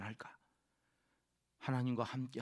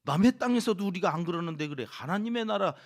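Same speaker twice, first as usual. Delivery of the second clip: much louder, higher rebuke; furious shout; 4 semitones higher, 8 dB louder than usual. Retold or imitated. Ezra imagined shouting at Jerusalem's people, furious yell. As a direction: yell it like a leader punishing sinners. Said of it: "막 소리를 지르고".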